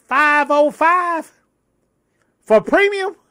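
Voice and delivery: rising high and slow in outrage; retold disbelief. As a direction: stretch it out, shocked by the price. Retells outrage at gas price.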